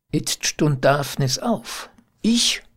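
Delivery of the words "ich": high, indignant cry of disbelief; exclaiming. A narrator's reading. Daphnis, accused of being unfaithful, exclaiming in disbelief. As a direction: pitch it up sharply as a stung question. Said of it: "rief er".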